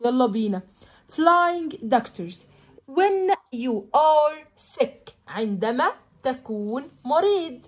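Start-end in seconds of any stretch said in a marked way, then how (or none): 1.1-5.1 slow textbook reading. Shown as a demonstration. Teacher reading the lesson text aloud to pupils, slow and clear. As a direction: read each English phrase out slowly for the class.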